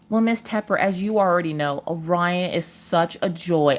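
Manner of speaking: softly stalling schoolteacher impression; imitated teacher. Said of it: "had to contact the teacher".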